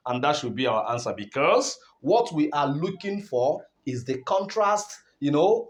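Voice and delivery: ordinary talk, nothing marked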